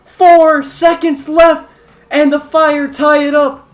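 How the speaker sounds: slow, loud, high goal call; excited shout. Real, not acted. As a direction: stretch out the big call. Goal call in final seconds.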